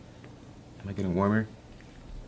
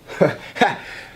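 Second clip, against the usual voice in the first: theatrical laugh; 6 semitones higher than usual. Acted. He launches a melodramatic act.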